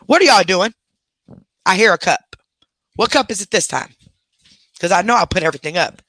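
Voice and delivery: loud, exasperated scolding; scolding the dogs. Real, not acted. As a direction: call out the misbehaving dogs.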